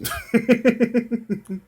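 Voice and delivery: high run of quick giggles. Real, not acted. Amused by rude spirit guide.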